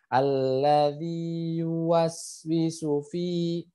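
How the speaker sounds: slow chanted alladzi yuwaswisu fi; slow recitation. Shown as a demonstration. Teacher reciting the verse slowly. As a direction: recite with exact lengths. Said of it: "diperhatikan panjang pendeknya".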